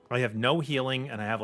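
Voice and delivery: ordinary talk, nothing marked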